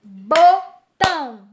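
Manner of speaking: BOTÃO said slowly as two beats, "bo... tão"; split into syllables. Shown as a demonstration. Teacher demonstrating the syllable rule, slow split syllables. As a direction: break Botão in two, each part drawn out. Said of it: "A regrinha fala".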